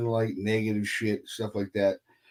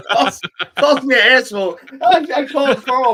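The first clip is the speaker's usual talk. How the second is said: loud, high words through laughter; real laughing; 12 semitones higher, 8 dB louder than usual. Real, not acted. Storyteller cracking up over being called an asshole, laughing. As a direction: crack up while getting it out.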